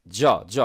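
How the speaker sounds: 'জ' with a rounded 'aw'; British vowel. Shown as a demonstration. Teacher drilling the British 'জ', rounded vowel. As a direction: isolate the British 'jaw' sound in 'জ'. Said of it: "British হলে হতো".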